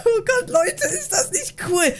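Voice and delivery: high, giggly amused voice; laughing delight. Real, not acted. Prankster delighted the tickling worked, laughing. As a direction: talk through your giggles.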